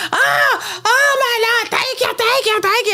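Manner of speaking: high hammed-up wailing thanks; mock screaming and crying. Church lady mocked falling to her knees, high fake wailing. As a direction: play the fake overcome churchgoer, high and shouting. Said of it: "screaming and crying".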